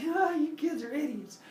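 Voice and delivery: high, giggly put-on chuckle; amused Father Sky. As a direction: chuckle it high at the silly kids. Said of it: "Father Sky would laugh and giggle".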